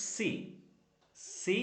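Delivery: ordinary delivery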